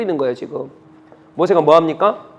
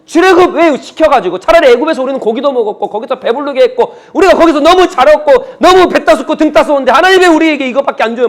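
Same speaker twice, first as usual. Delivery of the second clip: heated, higher-pitched protest; complaining; 4 semitones higher, 4 dB louder than usual. Acted. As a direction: imitate a crowd grumbling at God, raised and loud. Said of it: "막 그러면서 불평한단 말이에요".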